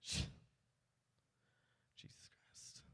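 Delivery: a drained, breathy Christ; comic giving up on the set; exhausted whisper. Real, not acted. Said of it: "whispered Christ".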